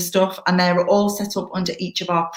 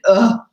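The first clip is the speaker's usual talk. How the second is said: acted exasperated groan; groan of dread; 3 semitones higher, 6 dB louder than usual. Jodie privately dreading the PTA request, acted groan. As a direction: let out a loud, dismayed "ugh". Inner dread at being asked.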